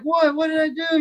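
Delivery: imitating a scared newcomer; mock startled fear. Ed startled by news of angry cartel guy. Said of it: "And Ed's like".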